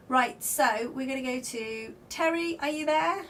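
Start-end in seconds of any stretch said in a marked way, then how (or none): none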